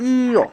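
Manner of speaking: ইয় sounded as i gliding into open o, pitched high; pronunciation demo. Shown as a demonstration. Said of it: "তাহলে উচ্চারণ হবে কি".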